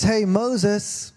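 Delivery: a light, high-pitched call as God; mock God calling out in a high voice; hammed-up high God voice calling Moses; high squeaky God voice. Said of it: "Well, God doesn't sound like that".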